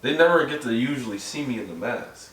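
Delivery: muffled through a mask; inside a rubber mask. Real, not acted. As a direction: speak from behind a rubber mask. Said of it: "It's hot in this mask".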